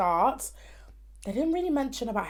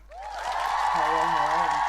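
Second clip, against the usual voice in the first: silly hello voice; 4 semitones lower, 5 dB louder, much slower than usual. Pretend. Odd greeting voice.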